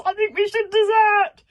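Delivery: high, loud panicky dragon screech; shrieking. Acted. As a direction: shriek the plan in terror. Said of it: "shrieked Toothless".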